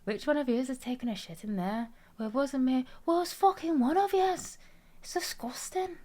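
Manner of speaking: high, hammed-up Scottish voice; Scottish accent. Acting out a toilet meme in a hammed-up Scottish accent. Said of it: "I have a lesser known Scottish accent".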